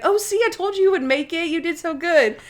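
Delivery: high, sing-song praise voice; encouraging Natalie. Retold or imitated. Natalie praising Monk after crossing the street, high encouraging coo. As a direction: coo it like proud praise for a nervous friend. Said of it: "Natalie's like".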